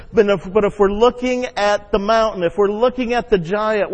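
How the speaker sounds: normal talking voice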